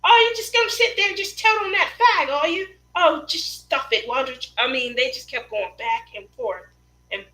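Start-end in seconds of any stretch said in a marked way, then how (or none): 0.0-4.5 high, loud mock British bickering; put-on British accent, heated and pitched up; arguing British women. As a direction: imitate two UK women squabbling. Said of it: "they would argue all the time".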